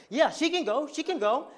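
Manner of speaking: put-on high, loud insistent older relative; Mama Fran. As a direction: pipe up loudly, imitating her giving permission. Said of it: "then Mama Fran was like".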